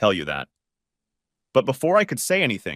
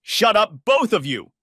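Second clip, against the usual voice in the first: high, loud shout; furious shout at the two of them; 12 semitones higher, 4 dB louder, slower than usual. A narrator's reading. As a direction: yell them both silent. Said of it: "Scylla shouted at her".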